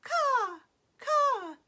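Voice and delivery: high, loud cawing bird call; raven caw. A narrator's reading. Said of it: "The raven's cry came again".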